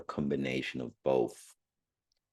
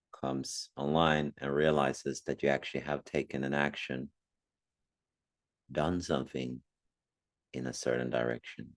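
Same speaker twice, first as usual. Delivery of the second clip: calm meditation guide with long pauses; unhurried meditation voice, phrase by phrase; guided meditation. Acted. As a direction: gently guide listeners inward, leaving long silences.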